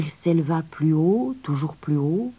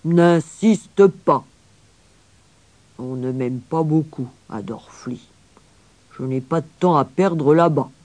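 stern grandfather; 4 dB louder than usual. Acted. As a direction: refuse curtly as the grumpy grandfather. (grand-père sévère et bourru)

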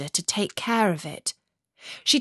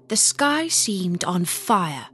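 ordinary delivery, nothing marked

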